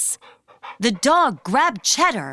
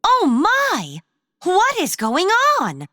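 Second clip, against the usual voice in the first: high, startled gasp of a cry; shocked squeak of alarm, pitched way up; 11 semitones higher, 3 dB louder than usual. Acted. Clara tied up by mice, crying out in shock. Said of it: "Clara was in shock".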